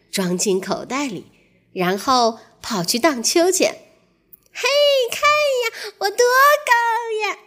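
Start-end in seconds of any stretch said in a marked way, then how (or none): 4.5-7.4 high, happy little mouse calling out; happy cry. Acted. Mouse on the swing calls to friends, high happy cry. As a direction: call out with joy from the swing. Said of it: "它开心地叫道".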